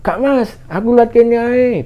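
high, slow, drawn-out holler; imitated calling back. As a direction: answer someone far away. Hiker calling back from afar. (menirukan sahutan panjang dari jauh)

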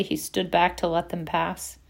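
ordinary delivery, nothing marked

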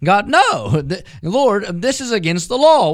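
horrified no to God; Peter refusing. Acted. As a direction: push back, scandalised. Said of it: "He says".

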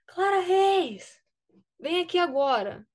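mock coach yelling her name; retold yell. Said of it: "Ele deu um maior gritão".

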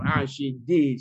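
loud, high fervent preaching; full-force preaching. Acted. Pastor urging congregation to pray aloud, fervent shouting. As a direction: preach it out with all your strength.

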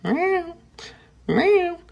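high-pitched mock meow; cat meow imitation. Imitating his cat's meow, very high. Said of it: "periodically go".

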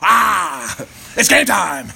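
shouted pre-game hype from a coach; football coach. Acted. Coach rallying his team at game time, hyped shouting. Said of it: "Any coach, any fucking defensive line coordinator".

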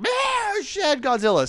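high startled ah shit Godzilla; mock shock. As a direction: squeal it in mock alarm. Mock reaction to big penis.

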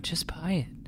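breathy unvoiced whisper. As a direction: hiss it from the background. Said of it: "[name] in the background".

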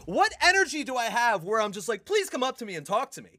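high-pitched incredulous rant; exasperated mock plea. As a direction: go way up high, mocking your own supposed invitation. Exasperated rant with mocking invitation.

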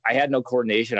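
ordinary talk

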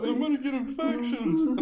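very high, loud mock panic through laughter; mocking mimicry. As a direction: mimic a ridiculous worrier while laughing. Listener mockingly mimics the man, laughing.

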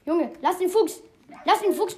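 lauter, höherer Ruf gegen den bösen Hund; aufgeregtes Schimpfen. Real, not acted. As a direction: schrei den Hund weg, der den Fuchs angreift. Dog attacks his fox. (schimpft laut den Hund an, der den Fuchs angreift)

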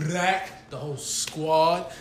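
hyped shout. Real, not acted. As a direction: stretch it out big and loud. Hyped show intro shout.

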